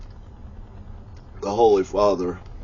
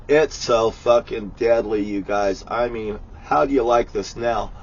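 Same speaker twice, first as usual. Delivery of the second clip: ordinary talk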